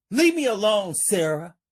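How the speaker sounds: high, loud, frightened plea; scared plea. Acted. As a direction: beg her to stop, panicked. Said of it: "Marcus is getting scared".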